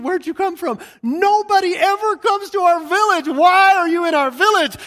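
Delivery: put-on high, loud old woman calling out in surprise; startled old lady. Old lady greets a stranger entering her village, high and loud. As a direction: play the village grandmother, loud and amazed.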